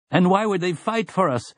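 high-pitched character voice of a doubter; objecting villager. A narrator's reading. Villager doubting three can beat sixty, high objecting voice. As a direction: argue the odds in a high voice.

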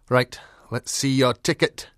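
loud, gruff Scottie dog. A narrator's reading. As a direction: demand it gruffly, like an old usher dog. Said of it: "he said gruffly".

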